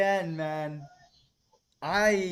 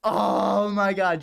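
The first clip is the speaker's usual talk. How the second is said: exasperated; 6 semitones higher, 8 dB louder, much slower than usual. Real, not acted. Ranting about the fumble.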